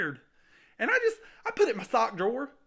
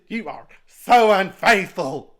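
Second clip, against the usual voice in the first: slow, much louder, lower verdict; condemning boss; 5 semitones lower, 11 dB louder, slower than usual. Acted. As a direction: pronounce "unfaithful" like a judgment. Boss condemns untrustworthy worker.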